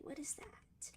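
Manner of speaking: whispered puzzled question. Real, not acted. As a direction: whisper it to yourself. Puzzled at the order.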